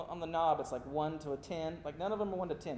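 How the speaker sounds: usual speaking voice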